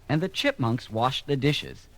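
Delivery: ordinary delivery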